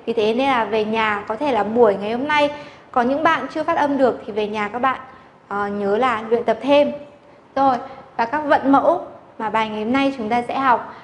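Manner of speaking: usual speaking voice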